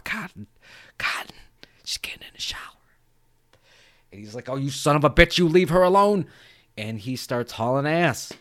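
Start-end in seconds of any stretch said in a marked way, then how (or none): 0.1-2.6 hushed taunt fading to a whisper; teasing. Retold or imitated. Killer teasing Cotton about his girlfriend, taunting whisper. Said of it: "Ghostface is teasing him". 4.5-6.3 loud, raised protective threat; mock outrage. Cotton defending girlfriend from the caller, angry.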